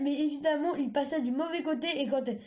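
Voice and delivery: plain reading voice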